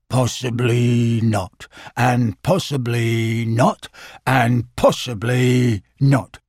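low, slow, mournful Eeyore drawl; gloomy donkey. Acted. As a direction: sigh it out like a glum old donkey.

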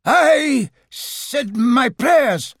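high, slow wail with a halting gap; hesitant, unconvincing ghost. A narrator's reading. Fake ghost guessing at Uncle Robert's habits, hesitant. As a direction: fumble for an answer in a ghostly voice. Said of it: "in a rather hesitant and unconvincing way".

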